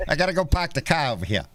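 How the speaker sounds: hammed-up Boston accent with dropped r's. Host shows callers an accent he loves, mock Boston accent. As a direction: do a playful Boston "park the car". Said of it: "a Boston accent".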